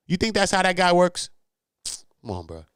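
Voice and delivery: normal talking voice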